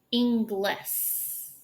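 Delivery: Inglis with a plain s at the end; Inglis, s instead of sh; Inglis, thin s ending; mispronounced Inglis. Shown as a demonstration. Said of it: "You see how I form that differently".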